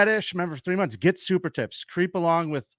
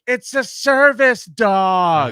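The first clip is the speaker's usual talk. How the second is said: long, drawn-out high yell; yelling heckler; 8 semitones higher, 10 dB louder, much slower than usual. Retold or imitated. Drunk woman insisting on her service dog, slow imitated yell. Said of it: "the shit she was yelling".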